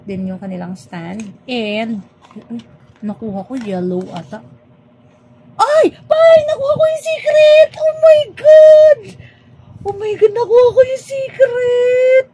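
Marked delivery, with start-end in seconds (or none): 5.5-12.2 much higher and much louder, screaming with joy; ecstatic shriek. Real, not acted. Unboxer screaming to viewers on pulling the secret figure, ecstatic. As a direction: shriek like you just won the jackpot.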